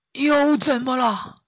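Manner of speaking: high, slow exasperated groan; fed up being woken; annoyed. Acted. Master woken again by pig, slow and exasperated.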